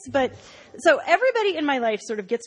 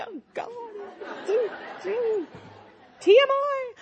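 high, pained TMI groan; mock disgusted plea; 10 semitones higher than usual. Daughter begs mom to stop oversharing, pained and high. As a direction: beg mom to stop, squirming.

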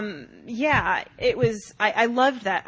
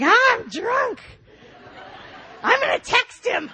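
put-on drunk baby voice; 14 semitones higher, slower than usual. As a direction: play a tipsy baby. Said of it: "still doing the drunk baby voice".

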